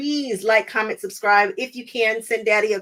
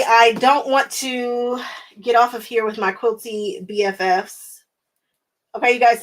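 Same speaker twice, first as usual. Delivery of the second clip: ordinary delivery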